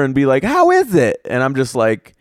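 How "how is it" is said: very high-pitched mock waiter check-in; chirpy waiter. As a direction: imitate an over-eager server in a squeaky-high voice. Imitating waiter checking on table.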